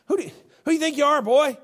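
fast, scornful who-do-you-think-you-are; mock taunt at a young upstart; rapid challenge. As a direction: fire the challenge quickly.

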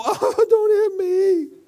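high, slow, whimpering plea; hammed-up scared whimper; mock cowering Jesus. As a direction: whine it like a frightened coward. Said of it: "he did not go".

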